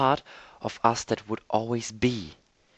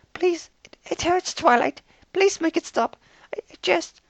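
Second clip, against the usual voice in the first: high, shaky pleading; frightened stammer; 5 dB louder than usual. A narrator's reading. Fluttershy begs Twilight to make it stop, frightened. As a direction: beg through fear.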